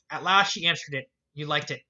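plain reading voice

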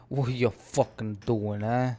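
slow, lightly put-on Italian-American mobster accent. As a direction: drawl it like a tough-guy wiseguy, half-joking. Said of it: "Just the accent".

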